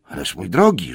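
exclaimed mild reproach; reproachful wizard. A narrator's reading. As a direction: chide a dear friend aloud. Gandalf reproaches the dawdling hobbit.

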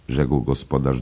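ordinary delivery

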